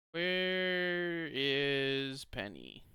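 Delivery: slow, stretched-out search; slow sing-song where is Penny; drawn-out question. Real, not acted. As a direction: drag every word out while looking. Streamer drawls while searching.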